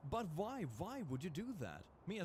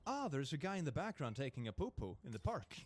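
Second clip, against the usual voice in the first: normal talking voice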